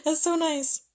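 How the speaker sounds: high, loud, delighted. Real, not acted. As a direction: gush over a kind gift. Touched by a viewer's heart.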